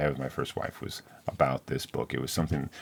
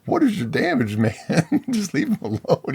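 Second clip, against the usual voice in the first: much higher, laughing mock outrage; incredulous defense; 12 semitones higher, 3 dB louder than usual. Reader laughingly tells the hunter to leave the brave alone, higher. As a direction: laugh in disbelief at the bully.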